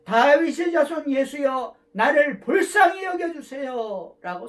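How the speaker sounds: loud, slow cry for mercy; shouted plea. Retold or imitated. The blind man cries to Jesus past the crowd, loud slow plea. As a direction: call out with all your strength. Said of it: "소리를 질렀습니다".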